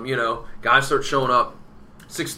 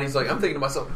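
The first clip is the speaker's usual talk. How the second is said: ordinary talk, nothing marked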